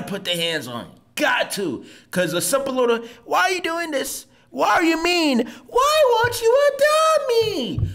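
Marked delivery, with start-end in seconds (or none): 3.2-7.8 higher whining plea, building to a drawn-out wail; hammed-up pleading that stretches out; mock pleading. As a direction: plead helplessly, climbing much higher by the end. Mocks pleading talk as useless.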